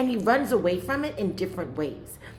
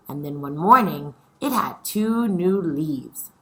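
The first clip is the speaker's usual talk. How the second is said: louder, lively storytelling voice; story reading to children; 11 dB louder than usual. A narrator's reading. Teacher reading chapter four to her scholars, lively. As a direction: read it out like a picture book for kids. Reads the story aloud to class.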